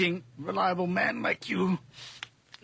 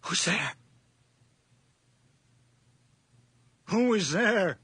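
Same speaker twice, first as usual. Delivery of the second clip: frightened call; 5 semitones higher than usual. Acted. Old man startled in the dark.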